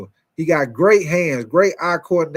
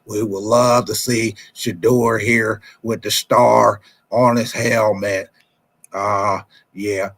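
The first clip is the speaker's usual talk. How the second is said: low, slow drawling team owner voice; a slow, deep mock of the Cowboys owner at the podium; Jerry Jones impression; 7 semitones lower, slower than usual. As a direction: drag the words out like an old Texas owner. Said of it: "That's your boy Jerry Jones".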